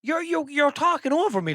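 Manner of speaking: high-pitched, stammering exasperation; exasperated complaint. Real, not acted. Podcaster scolds AI assistant for interrupting, exasperated. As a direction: protest in a high, fed-up voice.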